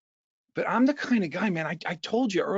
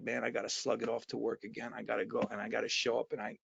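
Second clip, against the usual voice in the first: much softer, lower, dragging complaint that speeds up; weary grumble; 4 semitones lower, 10 dB quieter than usual. Retold or imitated. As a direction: sound worn out before the day starts. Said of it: "you wake up in the morning and you're like".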